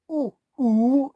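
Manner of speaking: slow rounded "ooh", held long; original ooh sound. Shown as a demonstration. Showing the original vowel of the letter, held. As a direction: draw the ooh out slowly and round it. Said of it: "original use of that letter ooh".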